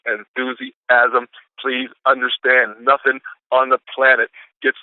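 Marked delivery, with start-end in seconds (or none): 0.1-1.3 enthusiasm stretched very slowly, every syllable held; slow right down and savour enthusiasm; drawn-out key word. Shown as a demonstration. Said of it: "That's what we're gonna face off with this week".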